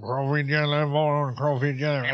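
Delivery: mock Cajun accent. Podcast host tasting Cajun jelly, joking mock Cajun accent. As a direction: ham up a Cajun cook savoring it.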